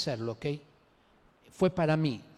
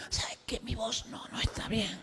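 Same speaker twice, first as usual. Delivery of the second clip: weak, cracked sick voice, put on; feigned lost voice; 3 semitones higher, 6 dB quieter than usual. Coward excusing himself to God, feigned lost voice. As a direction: fake a failing voice after a cough. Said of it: "está excusándose que no tiene ni voz".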